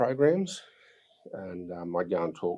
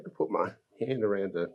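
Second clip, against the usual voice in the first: ordinary talk, nothing marked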